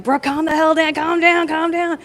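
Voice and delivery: high, strained self-scolding; getting so mad. Retold or imitated. Plumber talking herself down while getting mad, high and frantic. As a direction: imitate a frantic attempt to calm yourself. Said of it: "I was getting so mad".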